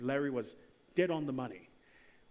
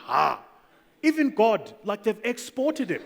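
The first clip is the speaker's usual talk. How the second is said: louder and higher, mock amazement; amazed exclaim; 5 semitones higher, 9 dB louder, slower than usual. Speaker marveling at Nigerian churches abroad, loud mock amazement. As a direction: throw up your hands, half-laughing disbelief. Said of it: "I'm like".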